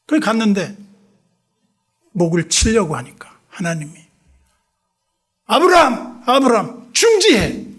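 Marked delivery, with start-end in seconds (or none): 5.5-7.6 loud, high urgent calling; divine call. Retold or imitated. God halting Abraham's knife, loud urgent call. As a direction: call out to stop him.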